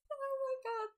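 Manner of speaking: very high, giggly squeak; nervous giggle. Real, not acted. Streamer stuck on a difficult question, high nervous giggle. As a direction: let nervous laughter push the pitch way up.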